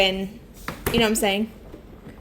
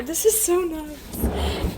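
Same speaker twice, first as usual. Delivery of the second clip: dog voice; 7 semitones higher, much slower than usual. Real, not acted. Marvelling at fridge space.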